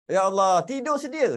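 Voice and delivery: hammed-up exasperated sigh at a sleeper; scolding Kedah elder. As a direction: play the old Kedah parent nagging. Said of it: "orang-orang tua Kedah dulu selalu cakap".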